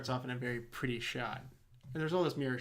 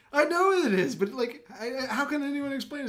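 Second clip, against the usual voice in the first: high, loud mock-distraught husband; frantic husband; 10 semitones higher, 7 dB louder than usual. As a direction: wail it in hammed-up despair.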